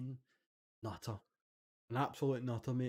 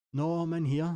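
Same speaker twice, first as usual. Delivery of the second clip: raised, higher answer through a wall; calling back; 5 semitones higher, 6 dB louder than usual. Retold or imitated. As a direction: shout back from the next cell.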